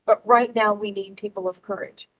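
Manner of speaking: ordinary talk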